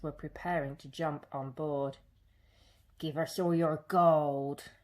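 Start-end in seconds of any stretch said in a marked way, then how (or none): 3.0-4.7 lightly voiced pirate demand; a hint of a menacing pirate gang; nasty pirates. A narrator's reading. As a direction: raiders demanding gold. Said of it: "they cried".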